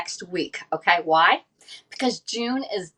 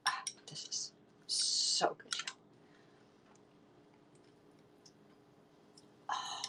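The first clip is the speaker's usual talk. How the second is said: savoring whisper; 15 dB quieter, slower than usual. Real, not acted. Tasting coffee, savoring it.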